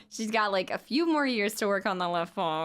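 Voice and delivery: ordinary talk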